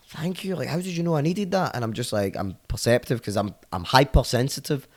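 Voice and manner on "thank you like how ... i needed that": grateful tripper. Retold or imitated. Tripping guest thanking him for water, high and amazed.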